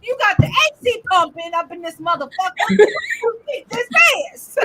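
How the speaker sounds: high mock-furious scolding, like a strict mother; hammed-up scolding rant at herself. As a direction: chew yourself out, young lady. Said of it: "Who is she screaming at".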